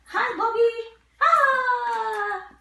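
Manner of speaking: high, bright hello; excited greeting. Real, not acted.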